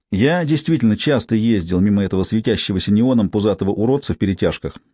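ordinary delivery, nothing marked

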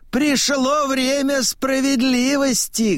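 high, sustained declaration; old man proclaiming. A narrator's reading. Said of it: "говорит он".

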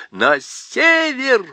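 dwarf rallying everyone north; dwarf cries out. A narrator's reading. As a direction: call it out like a battle cry. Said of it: "вскричал гном".

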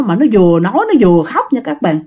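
plain reading voice

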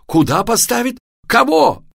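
high, loud panicked questions; screaming. A narrator's reading. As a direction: shriek in horrified disbelief. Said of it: "закричал, как резаный папа".

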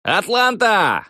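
high, slow yell to the stadium; shouting to a crowd. Retold or imitated. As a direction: call out to the whole arena. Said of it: "заорал я".

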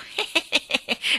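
high cackle in quick hehs; old man's cackle. Acted. Great-grandfather mocking the trapped girl, high cackle. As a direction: cackle to taunt the child.

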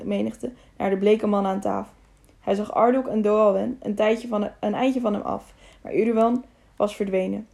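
usual speaking voice

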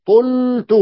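طلت said high with a clear u, tultu; correct vowel demo. Shown as a demonstration. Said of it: "قل".

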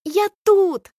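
high excited shout; yelling. A narrator's reading. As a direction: shout to be heard over crowd. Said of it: "вопит Алька".

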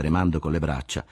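usual speaking voice

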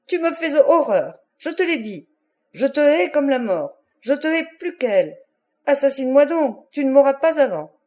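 high-pitched furious outburst; hateful cry. A narrator's reading. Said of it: "cria-t-elle".

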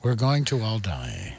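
slow, low doom-laden announcement; mock doom. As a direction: draw it out low, hammed-up. Mock doom after fake alarm.

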